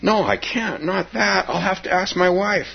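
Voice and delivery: high pleading voice of a reluctant man. Acted. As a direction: beg off, voice up high. Story character pleading, quoted.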